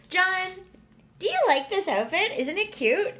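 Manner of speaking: high, lilting, leading question; liking-it tone. Shown as a demonstration. Woman showing John she likes the outfit, high leading tone. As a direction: ask it high and eager, fishing for a yes. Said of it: "exactly how you could tell if I like".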